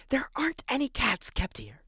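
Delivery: hushed scolding whisper; librarian whisper. Acted.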